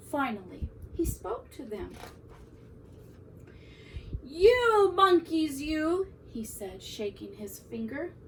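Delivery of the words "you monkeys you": loud, slow, higher-pitched telling-off; scolding peddler. A narrator's reading. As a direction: scold them with drawn-out indignation. Peddler scolds monkeys, shaking finger.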